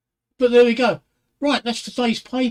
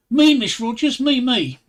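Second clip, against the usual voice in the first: put-on eager child; 5 semitones higher, 6 dB louder than usual. Child eagerly volunteering in class.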